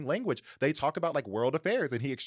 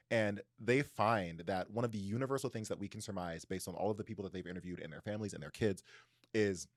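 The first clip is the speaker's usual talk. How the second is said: usual speaking voice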